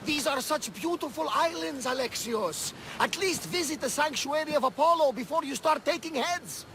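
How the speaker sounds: pitched high, coaxing and eager; eager tour guide gushing about the islands; excited pleading. Acted. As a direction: sell the islands with bright enthusiasm. Urging him to sightsee.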